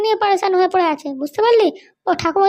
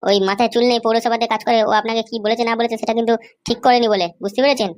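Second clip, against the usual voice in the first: low, mocking thug voice; young thug; 9 semitones lower than usual. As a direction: taunt the old man from deep in the chest. Young thug confronts old man. (নিচু গলায় ঠাট্টা করা গুন্ডা)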